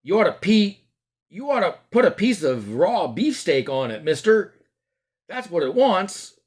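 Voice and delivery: put-on chirpy street boy advice; butcher's boy.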